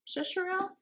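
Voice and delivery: "Shasharel" as a soft, high question; doubtful high guess at "Shasharel"; unsure pronunciation. Real, not acted. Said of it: "I'm gonna mispronounce this".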